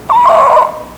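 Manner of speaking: very high horrified "oh"; acted high-pitched cry of dismay; horrified gasp. Father seeing his daughter come out, shocked high gasp.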